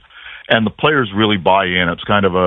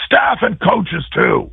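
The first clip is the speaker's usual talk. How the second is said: calling out; 11 semitones higher, slower than usual. Retold or imitated. Coach calling staff out too.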